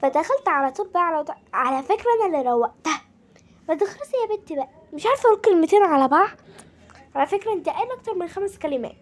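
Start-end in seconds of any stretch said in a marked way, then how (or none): 3.7-6.4 fast, high, impatient nag; impatient nagging. Real, not acted. Girl nagging her sister to finish, fast and impatient. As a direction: rush it, fed up with her. Kids bickering over filming.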